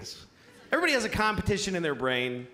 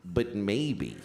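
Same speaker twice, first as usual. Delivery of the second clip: much lower, insinuating murmur; sly low voice; 10 semitones lower than usual. Shown as a demonstration. Naming the bad half of his brain, low and sly. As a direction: drop down low for the bad thought. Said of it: "a category in my brain that I call".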